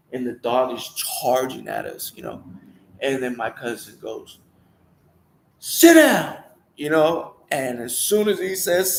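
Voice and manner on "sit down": high, loud one-word-pair order; sharp command. Retold or imitated. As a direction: snap it out to stop a charging dog. Cousin commanding charging dog.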